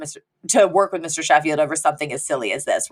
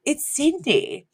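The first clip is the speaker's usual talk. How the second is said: sly quiet reply; 7 semitones higher, 5 dB quieter, slower than usual. Retold or imitated. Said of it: "And she goes".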